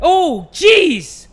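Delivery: loud, high burst of surprise; startled yelp. Real, not acted. Player startled by the kids turning, startled yelp. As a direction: exclaim as if jolted.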